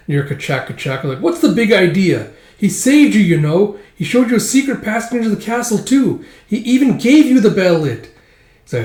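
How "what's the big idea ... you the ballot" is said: high, loud protest; scolding little sidekick; indignant elf. Acted. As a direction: argue his case in a squeaky, outraged voice. Elf angrily defends old man.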